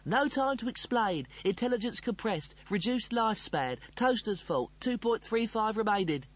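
high-pitched telegram-like bursts; urgent. Acted. Computer with no time to explain, urgent. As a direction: fire it out in urgent fragments.

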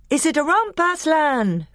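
high, eager calling out; excited cry. A narrator's reading. A youth asks Aslan about the romp, crying out. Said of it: "cried the youth".

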